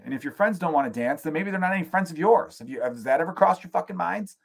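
ordinary talk, nothing marked

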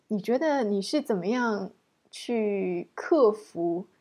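usual speaking voice

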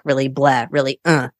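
usual speaking voice

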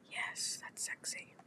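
teasing breathy whisper. Pretend. Host teases friend during fake ASMR, whispering. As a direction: whisper it as a joke seduction.

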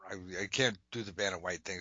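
ordinary delivery

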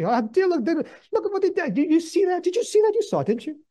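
high-pitched, indignant sputtering; hammed-up rage, voice shooting up high; mock outrage. Preacher imagining himself spat on, acted high-pitched outrage. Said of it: "I'd be filled with rage".

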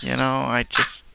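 ordinary talk, nothing marked

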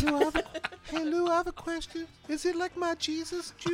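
high-pitched teasing question; high silly voice. Acted. High-pitched calling to Lou.

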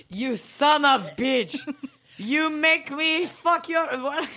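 high, loud, slow fake Russian; mock Russian accent. Co-host copying host's accent bit, loud mock Russian. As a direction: ham up a Russian accent, slowly and loudly.

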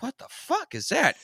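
high disbelieving what; shocked. Retold or imitated. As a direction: blurt it out in shock. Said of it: "He's like".

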